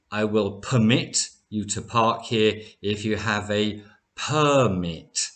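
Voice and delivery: first "permit" as per-MIT, last "permit" as PER-mit; verb and noun stress. Shown as a demonstration. Teacher repeats the example, "permit" per-MIT then PER-mit. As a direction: move the stress in "permit" from end to front. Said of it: "So permit is a verb".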